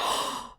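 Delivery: quiet breathy gasp of realisation; soft unvoiced gasp. Real, not acted. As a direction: gasp softly as it sinks in. Realises what killed her.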